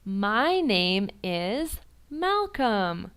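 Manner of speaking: extremely slow, spaced-out reply; very slow answer. Pretend. Teacher patiently answering confused child very slowly. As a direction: spell it out word by word. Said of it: "And you'll be like".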